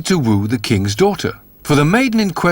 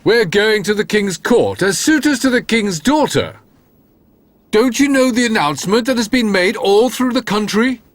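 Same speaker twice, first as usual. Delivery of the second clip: put-on higher, lofty young men explaining; the clever brothers; 7 semitones higher than usual. A narrator's reading. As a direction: talk down to your dull brother, pleased with yourselves. Brothers answer Jack haughtily.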